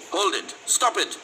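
sudden whip-crack interruption. A narrator's reading. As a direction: break up the fight with a crisp order. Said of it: "A voice cracked like a whip".

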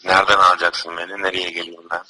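ordinary delivery

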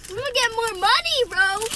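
high, loud thrilled boast; excited. Real, not acted. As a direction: cheer about the tooth money. Kid thrilled about tooth fairy.

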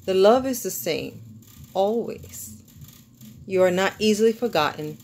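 measured, lilting poem recital with pauses between lines; poetry reading. Acted. Author reading a love poem to viewers, lilting poetry reading. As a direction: read it as a love poem, line by line. Reads second poem aloud.

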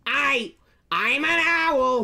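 high, slow squawking voice; high squawky impression. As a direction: squawk it out slowly. Imitating Gilbert Gottfried.

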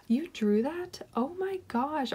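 gasp, then a high, slow amazed question; be stunned by the drawing; delighted disbelief; surprised. Real, not acted. Discovers the sender drew it.

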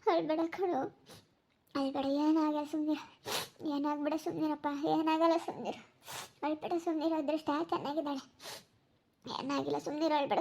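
hushed, soothing reassurance; soft consoling. Acted. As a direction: comfort someone in tears, softly. Consoling someone who is crying.

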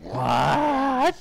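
high startled cry; shocked. Real, not acted. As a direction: yelp in disbelief. Shocked at missing upgrade.

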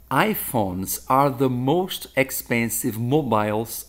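"iPhones are the most expensive mobiles" spoken slowly and deliberately; slow model sentence. Shown as a demonstration. Teacher giving a model sentence to the class, slow. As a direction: dictate the example at half speed. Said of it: "Então eu posso falar, por exemplo".

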